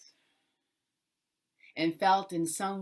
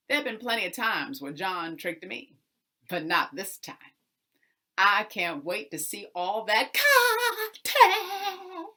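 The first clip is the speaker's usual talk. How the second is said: gloating to himself; 4 semitones higher, 9 dB louder than usual. Acted. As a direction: savour the cotton you think is coming. Mister Jones gloats to himself.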